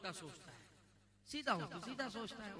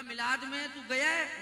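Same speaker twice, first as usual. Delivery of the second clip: loud, higher, slow challenge; challenging question; 6 semitones higher, 15 dB louder, slower than usual. Acted. Sunni grilling the objector, loud and slow. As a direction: corner him with the question. Acted argument about milad.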